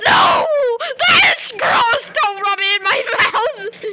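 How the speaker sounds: loud, high disgusted yelling; disgusted shouting. Real, not acted. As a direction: scream it out in disgust. Disgusted at friend's prank.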